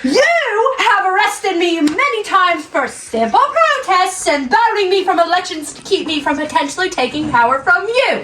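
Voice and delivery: loud, slow furious shout. Acted. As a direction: yell the accusation, drawn out. Protester shouts grievances.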